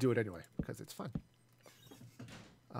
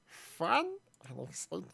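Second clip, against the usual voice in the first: fun voice; 2 semitones higher, 4 dB quieter than usual. Shown as a demonstration. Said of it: "I can then come in and be all".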